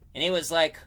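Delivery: ordinary delivery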